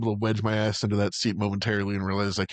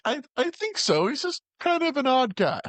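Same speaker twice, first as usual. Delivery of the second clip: higher, slow hesitant voice; mock unsure friend; 13 semitones higher than usual. Friend unsure about Alan, higher slow hedging. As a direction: hedge it slowly in a higher voice. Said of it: "he's like".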